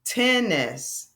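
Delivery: "Tennis" stretched into ten and ness; split into syllables. Shown as a demonstration. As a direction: give both syllables of "Tennis" full weight. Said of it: "So that's two syllables".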